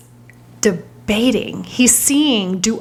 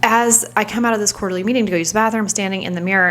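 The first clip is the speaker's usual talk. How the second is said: normal talking voice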